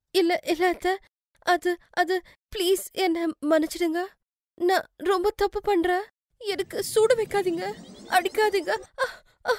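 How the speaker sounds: high-pitched panicked pleading; terrified, tearful. Acted. Daughter-in-law begging not to be burnt or beaten, terrified. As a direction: beg not to be punished, close to tears. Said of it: "எதுக்கு அழுதுட்டு இருக்க?".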